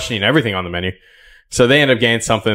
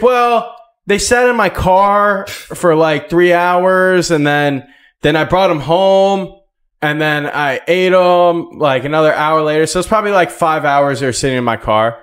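mimicking employee; 8 semitones higher, 4 dB louder, slower than usual. Voicing employee's rambling excuse.

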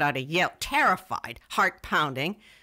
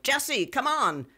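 yell; 9 semitones higher, slower than usual. Retold or imitated. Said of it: "Somebody yelled".

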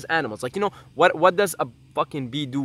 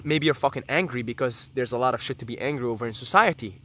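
ordinary delivery

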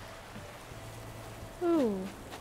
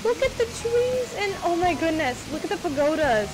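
much higher and louder, thrilled; excited gushing; 6 semitones higher, 4 dB louder than usual. Real, not acted. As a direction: squeal it out in delight. Amazed touring friend's island.

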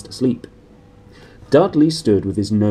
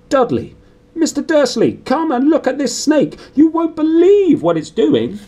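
much higher, much louder excited yell; deafening shout; 8 dB louder than usual. A narrator's reading. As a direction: shout across the reptile house to come and look. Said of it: "a deafening shout behind Harry".

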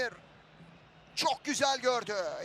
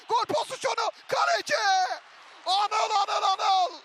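excited commentator shout; 5 semitones higher, 3 dB louder than usual. Real, not acted. Big chance, keeper saves.

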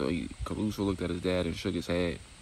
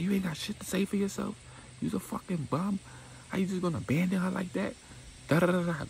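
high-pitched scolding woman's voice, going off; angry girlfriend voice; 12 semitones higher than usual. Retold or imitated. Destiny keeps going off.

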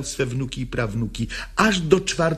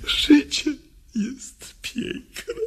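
higher, lifting the word Życie; rising hope; 11 semitones higher, much slower than usual. Acted. Woman repeating life is beautiful, higher. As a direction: let it lift with hope.

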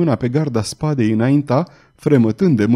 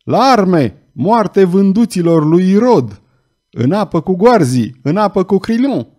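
loud, higher battle cries; furious crowd yell; 6 semitones higher, 5 dB louder than usual. Acted. Burghers calling each other to arms, loud furious yells. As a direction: shout the people to arms. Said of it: "urla de furie".

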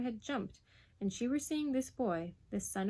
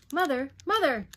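put-on loud, high boyish call; urgent cry; 6 semitones higher, 10 dB louder than usual. Boy bursts through the door calling his mother, urgent cry. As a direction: cry out for mother, falling at the end. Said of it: "the same cry for his mother".

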